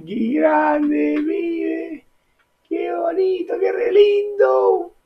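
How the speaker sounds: high-pitched excited gushing; high delighted squeal. Real, not acted.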